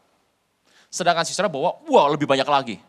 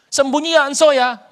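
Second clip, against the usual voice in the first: pleading to hide; 8 semitones higher, 11 dB louder than usual. Retold or imitated. Sisera begging to hide.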